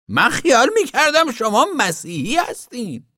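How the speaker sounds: very high, indignant accusation; angry zealot woman. A narrator's reading. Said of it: "حالتی خشمگین یافت و گفت". (خشمگین، با صدای خیلی زیر)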